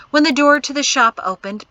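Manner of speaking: plain reading voice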